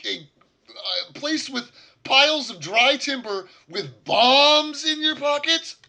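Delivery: loud, high, slow incredulous rant; furious scolding, each phrase hammered out at full volume; outraged shouting. Acted. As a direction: yell it slowly, aghast at such stupidity. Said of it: "He's like".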